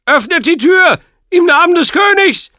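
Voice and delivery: booming high shout through a helmet; commanding shout. A narrator's reading. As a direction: bellow the royal order. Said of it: "Agravains Stimme ... rief".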